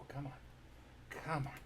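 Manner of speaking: usual speaking voice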